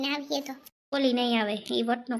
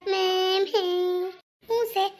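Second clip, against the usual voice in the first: put-on baby babble; 4 semitones higher, 3 dB louder, much slower than usual. Baby noise, then mother answers.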